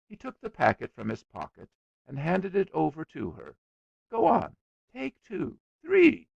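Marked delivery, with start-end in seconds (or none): 4.1-6.3 high, rising, eager offer; reckless generosity. A narrator's reading. Boy lavishly offering sweets to girl, recklessly generous. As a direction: press them on her, louder on the last. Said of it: "in reckless generosity".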